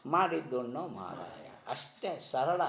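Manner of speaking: ordinary talk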